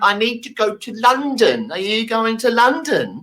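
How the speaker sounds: slow, high over-mouthed words; over-articulate slowly as if mouthing; mouthing exaggeratedly. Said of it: "And I'm mouthing".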